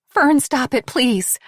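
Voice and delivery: frightened plea; begging. Acted. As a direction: beg her to stop, alarmed. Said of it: "Mare begged".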